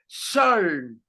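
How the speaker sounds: Shalm as one drawn-out syllable; basic pronunciation demo. Shown as a demonstration. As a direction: sound out Shalm, no vowel after the l. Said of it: "Tendríamos que estar pronunciando así".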